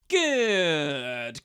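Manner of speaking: drawn-out cry of triumph; triumphant cry. A narrator's reading. Cranks performs victory for the guards, triumphant cry. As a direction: crow it out, victorious. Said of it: "cried Cranks in triumph".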